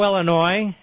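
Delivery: ordinary talk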